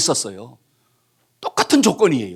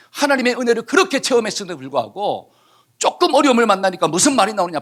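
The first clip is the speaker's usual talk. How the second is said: ordinary talk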